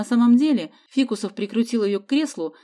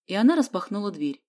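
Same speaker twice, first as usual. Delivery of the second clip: usual speaking voice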